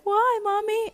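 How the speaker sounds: put-on high little-kid voice; imitated small child. Daughter asking her mom in the car, imitated child voice. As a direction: pitch it way up like a questioning child. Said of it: "And she's just like".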